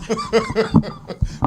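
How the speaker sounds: a sudden shrill burst of laughter; high squealing laugh. Real, not acted. Laughing at her friend's broken Spanish. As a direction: crack up, high and bright.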